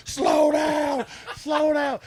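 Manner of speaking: high, loud frantic shouting; mock-panicked riders begging the horse to stop; panicked yell. Riders clinging on, yelling in panic. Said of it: "They're like".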